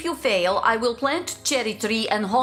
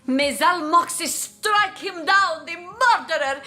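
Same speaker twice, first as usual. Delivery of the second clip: higher-pitched, impassioned curse; vengeful curse; 6 semitones higher than usual. Acted. Villager cursing the man who caused suffering, vengeful. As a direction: call down vengeance with rising force.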